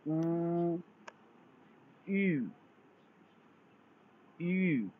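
clearly sounded finals आ, वो, अ, ई, ऊ, यू, each drawn out and stressed; Chinese finals demo. Shown as a demonstration. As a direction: model each vowel आ, वो, अ, ई, ऊ, यू slowly for students. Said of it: "इसकी pronunciation होगी".

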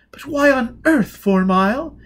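high and loud eager society voice; breathless with excitement to meet him; gushing young lady. Acted. Lady Elizabeth meeting Four Mile, gushing high.

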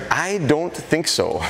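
slow, doubtful dog refusal; dog's reluctant voice. Retold or imitated. The dog Ellie refusing the trainer, slow reluctant voice. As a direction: drawl it out like an unwilling dog. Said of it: "She goes".

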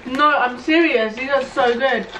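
speaking between crunchy bites; talking while chewing. Real, not acted. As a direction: mouth partly full of chips. Seen 0: Speaker unclear; both chew chips, man holds chip near mouth throughout.

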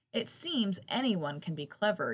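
ordinary delivery, nothing marked

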